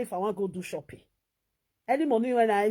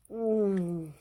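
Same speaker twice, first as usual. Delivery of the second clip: ordinary delivery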